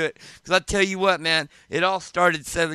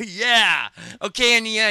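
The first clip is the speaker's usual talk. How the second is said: normal talking voice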